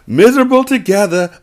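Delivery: high, loud mock-cheerful gloat. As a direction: sing it out like wicked good news. Gleeful spite at friend's misery.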